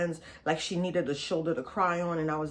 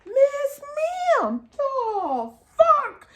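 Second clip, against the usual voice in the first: high, slow incredulous "ma'am, the fuck"; stunned disbelief; 7 dB louder, much slower than usual. Retold or imitated. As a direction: drag it out in total disbelief. Her stunned reaction to the attack.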